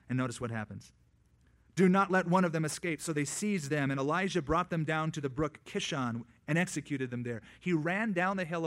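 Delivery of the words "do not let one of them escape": loud command. Retold or imitated. Elijah commands.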